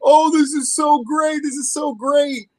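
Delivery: high, gushing mock-sobbing fan; crying fan. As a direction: gush like a fan moved to tears. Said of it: "There's gonna be people crying".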